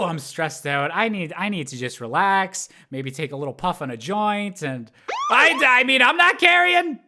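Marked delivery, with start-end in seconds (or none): 5.3-7.0 high, loud, panicked backpedal; flustered denial. Acted. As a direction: deny it loudly, caught out. Flustered denial about carrying a joint.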